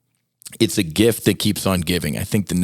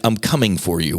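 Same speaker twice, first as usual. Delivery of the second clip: ordinary delivery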